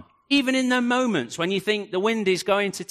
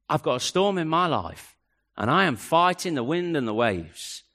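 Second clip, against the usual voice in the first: ordinary delivery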